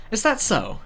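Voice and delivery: a hint of a fake-surprised camp leader; mock surprised woman voice. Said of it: "She looked surprised".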